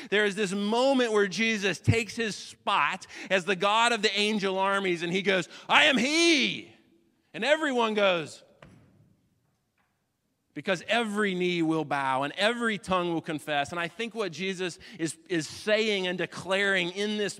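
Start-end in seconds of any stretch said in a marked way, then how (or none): 0.1-5.5 loud, high rising sermon climax; thundering pulpit declaration; full-force preaching. Acted. 5.7-6.7 loud, high and slow proclamation; Jesus proclaiming to the soldiers, booming; booming declaration. Acted. Jesus declares himself God. 7.3-13.7 loud, high rising sermon climax; thundering pulpit declaration; full-force preaching. Acted.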